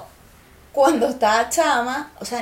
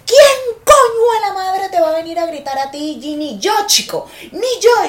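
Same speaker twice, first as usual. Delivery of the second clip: loud, higher outraged father; indignant dad; 8 semitones higher, 9 dB louder than usual. Retold or imitated. Dad defending his daughter, loud and outraged. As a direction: bluster like a protective dad.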